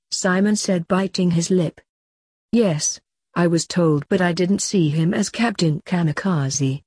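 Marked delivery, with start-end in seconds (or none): none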